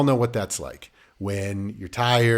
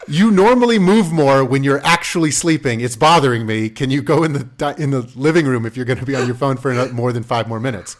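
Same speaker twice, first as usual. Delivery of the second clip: louder exasperated wife; annoyed wife; 3 semitones higher, 8 dB louder than usual. Retold or imitated. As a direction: complain loudly at a partner. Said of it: "she's like".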